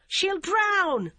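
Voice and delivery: high, loud alarmed plea. A narrator's reading. Barbara warns the girl will drown, high loud plea. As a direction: cry out in fear for her.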